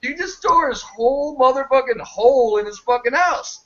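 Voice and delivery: high-pitched outraged protest, opening on a shout; indignant yelling. Retold or imitated. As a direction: yell back at the TV in outrage. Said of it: "And I'd go".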